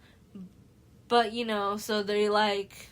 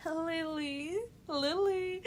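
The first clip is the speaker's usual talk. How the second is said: higher, softer sing-song call of her name; lovesick calling; 8 semitones higher, 3 dB quieter, much slower than usual. Retold or imitated. Sam mooning over Lily, higher lovesick sing-song call. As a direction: pine after her like a lovesick boy.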